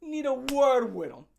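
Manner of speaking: loud, rough demand for KC; hammed-up heavy storming in; tough rep voice. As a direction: barge in calling for him. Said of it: "He was like".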